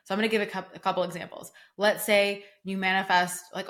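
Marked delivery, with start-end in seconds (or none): none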